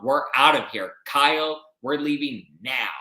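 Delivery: imitated indignant walkout, emphatic; mock storming off. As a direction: call out a cross order to leave. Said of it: "And then she storms off".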